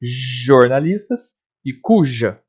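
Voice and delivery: slow "Jornalistas e cuja" with each j lengthened; J sound. Shown as a demonstration. Teacher testing the phoneme J, "Jornalistas e cuja" with long j. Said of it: "correspondem ao fonema J".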